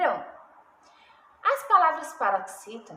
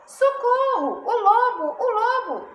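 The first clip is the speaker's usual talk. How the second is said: high, louder storybook cry for help; terrified cry; 8 semitones higher, 5 dB louder than usual. A narrator's reading. Shepherd boy calls villagers as the wolf attacks, terrified cry. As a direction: call out in alarm like a frightened shepherd boy. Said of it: "gritou o pastorzinho apavorado".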